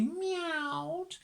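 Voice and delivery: high, long cat's meow on the word; drawn-out meow. A narrator's reading. Narrator voices Mog in her basket, meowing. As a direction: stretch the word into a cat's wail.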